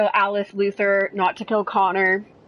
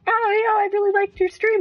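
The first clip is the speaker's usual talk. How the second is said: high gushing fan voice; 13 semitones higher, 3 dB louder than usual. Retold or imitated. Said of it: "they were like".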